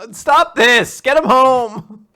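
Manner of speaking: loud, high mock protest; hammed-up outraged plea; exasperated shout. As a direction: yell it, drawing out the last words. Listener mock-protests the sad scene.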